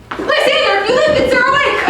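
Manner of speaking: high, loud, urgent call. Acted. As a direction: shout to wake him. Helena calling to sleeping Lysander.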